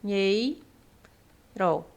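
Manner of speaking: very slow, one syllable at a time; long pauses between drawn-out syllables; separação silábica. Shown as a demonstration. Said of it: "é separado dessa forma". (sílaba por sílaba, bem devagar)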